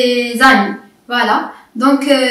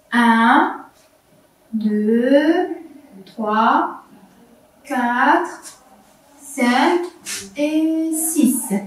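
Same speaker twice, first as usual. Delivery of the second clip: compte lentement, un mot à la fois; slow counting; 6 dB quieter, much slower than usual. Shown as a demonstration. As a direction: compte avec l'élève en détachant. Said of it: "on va compter ensemble". (comptage lent et doux)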